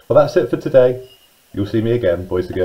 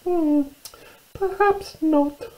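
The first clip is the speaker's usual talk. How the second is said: high, slow, weary mouse squeak; tired little mouse; 5 dB quieter, much slower than usual. Acted. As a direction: squeak it slowly, a touch deflated. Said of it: "feeling rather tired and still hungry".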